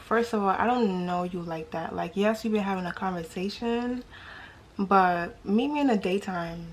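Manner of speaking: ordinary delivery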